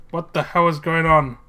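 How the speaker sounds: ordinary talk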